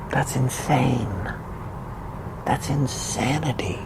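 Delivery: whispered, slow and soft; hushed whisper. Real, not acted. Horrified whisper about the Las Vegas shooting death toll. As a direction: breathe it out in disbelief.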